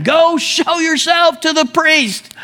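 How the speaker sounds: high, slow call carried over distance; Jesus calling back to the lepers, slow high call; retold yell. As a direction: call it slowly across the field. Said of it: "He yells back at him".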